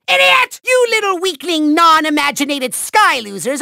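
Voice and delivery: insulting yell. Acted. Villain calling the Skylander a name, scornful yell. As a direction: hurl it as a hammy insult. Villain calls the hero idiot.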